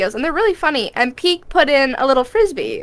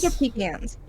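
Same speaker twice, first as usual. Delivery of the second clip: ordinary talk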